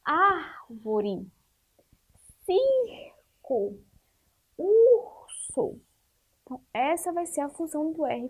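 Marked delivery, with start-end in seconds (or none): 0.1-5.8 "árvore, circo, urso", each split after a drawn-out breathy R; R makes the difference. Shown as a demonstration. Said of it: "como o R faz diferença". ("árvore, circo, urso" com o R soprado e alongado, em sílabas separadas)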